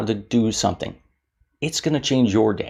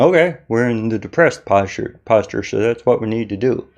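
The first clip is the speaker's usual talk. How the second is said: brain's voice. Pretend. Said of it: "your brain is going".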